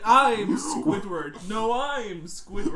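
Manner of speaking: loud slow mock insistence. Mock Last Supper bickering.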